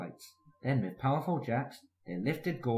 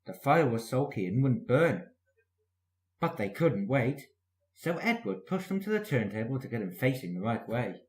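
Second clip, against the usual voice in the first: ordinary delivery, nothing marked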